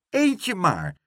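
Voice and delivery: high, loud indignant cry. A narrator's reading. Pluk defending himself to the porter, indignant. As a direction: protest like a wronged child. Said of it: "riep Pluk verontwaardigd".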